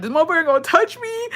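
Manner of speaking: high-pitched mock frightened whine; retold scared crying. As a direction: imitate a scared, crying kid. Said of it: "I'm crying at this point 'cause I'm scared".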